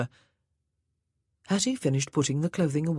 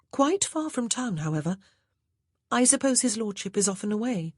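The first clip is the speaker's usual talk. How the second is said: higher, brighter genteel voice; a young lady at a loss; Grace, the new lady of the house; 6 semitones higher than usual. A narrator's reading. Grace to the maid, higher and lighter.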